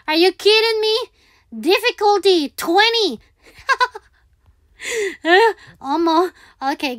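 ordinary delivery

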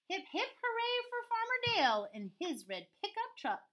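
high, swooping storybook hurrah; celebratory cheer. A narrator's reading. Story ends cheering the farmer, high happy cheer. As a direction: cheer high, then bounce to the finish. Cheer ending the picture book.

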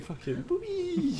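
high voice through laughter; giggly silliness. Real, not acted. Joking with friend after their show intro, giggly silliness. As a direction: squeak it out, cracking up.

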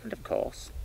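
ordinary talk

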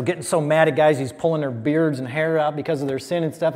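ordinary delivery

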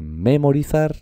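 memorizar stressed on ME, high; wrong first-syllable stress. Shown as a demonstration.

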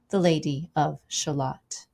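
usual speaking voice

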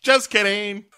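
high, loud teasing cat voice; mock-gleeful prank reveal; Grumpy Cat. Cat springing the same prank on Crystal, teasing. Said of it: "Grumpy Cat is just like".

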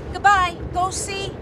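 ordinary delivery

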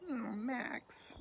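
ordinary delivery, nothing marked

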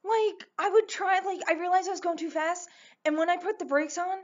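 high-pitched, loud, agitated storytelling; alarmed retelling. Real, not acted. Storyteller recounting failed brakes, high and alarmed. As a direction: pitch it way up as the danger hits.

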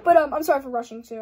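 fast, hurried apology; rushing. Real, not acted. Vlogger apologizing to viewers, talking fast. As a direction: rattle it off quickly. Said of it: "I don't know why I'm talking so fast".

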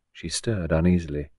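plain reading voice

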